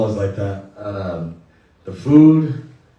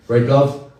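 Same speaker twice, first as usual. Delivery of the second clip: 웃으며 놀리듯 묻기; a playful jab at the governor, then a laugh; laughing tease; 4 semitones higher, 3 dB louder than usual. Real, not acted. Teasing the governor in the audience.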